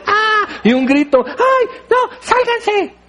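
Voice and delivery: high shriek of panic; grito asustado. Acted. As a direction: scream like a caught teenage girl. Pedro asustado como quinceañera. (grito agudo de pánico, como quinceañera sorprendida)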